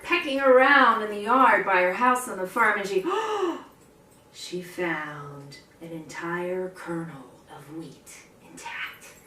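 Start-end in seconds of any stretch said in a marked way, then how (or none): 3.1-3.6 a sharp high gasp of discovery; surprised gasp. Acted. Hen finds wheat, surprised gasp. 4.3-9.1 softer, slower, awed; hushed wonder. A narrator's reading. Telling children the find, hushed awe.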